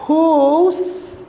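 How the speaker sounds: high breathy gust sound; whoosh of wind. Acted. Storyteller to class, mimicking wind whooshing down. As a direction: let it whistle past. Teacher imitates the wind again.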